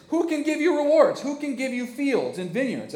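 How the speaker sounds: high, loud bragging; sulking king bragging to his servants; boastful king. Acted. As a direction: brag about what you can give. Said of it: "He says".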